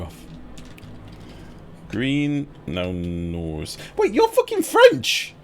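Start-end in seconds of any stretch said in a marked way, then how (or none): 4.0-5.3 very high, loud outburst; the penny drops, shout it; shocked realisation. Real, not acted. Streamer realising the viewer is French, shocked shout.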